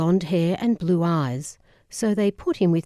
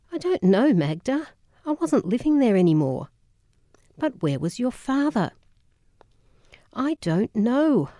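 exasperated; 6 semitones higher than usual. Real, not acted. Aunt pressed, exasperated.

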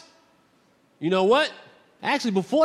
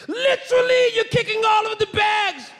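put-on British accent yell. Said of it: "He's yelling at me in British".

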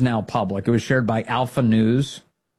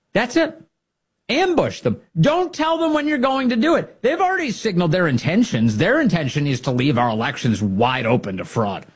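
fired up; 7 semitones higher than usual. Real, not acted. Rallying listeners to fight.

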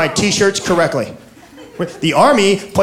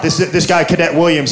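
normal talking voice

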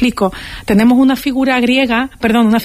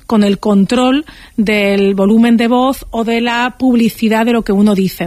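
ordinary talk, nothing marked

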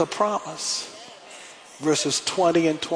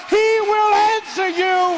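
very high, drawn-out preacher's cry; climactic preaching shout; 4 dB louder, slower than usual. Real, not acted. Preacher proclaiming God's promise to congregation, climactic preaching shout. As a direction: shout it out as the sermon's peak.

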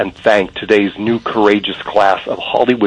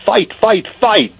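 imitated rallying shout; 11 semitones higher, slower than usual. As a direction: act out a rallying cry, pitched way up. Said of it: "and shouts".

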